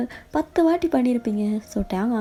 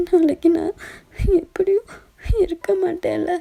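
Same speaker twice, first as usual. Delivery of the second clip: crying farewell; 6 semitones higher than usual. Acted. Dying girlfriend tells boyfriend to be happy, crying. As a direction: say goodbye through tears.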